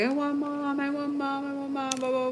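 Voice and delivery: put-on high, far-off little-kid whine; whiny child. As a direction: whine like a homesick kid wanting mom. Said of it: "She's like".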